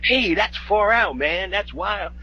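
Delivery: mock beatnik, high, loud and drawn out; hammed-up pretentious hipster enthusiasm; fake artist talk. Co-host imitating the film's beatniks admiring art, fake artist talk. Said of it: "doing the typical fake artist talk".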